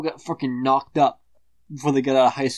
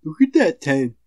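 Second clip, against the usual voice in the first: loud mocking leer; 3 semitones higher, 7 dB louder than usual. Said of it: "and say".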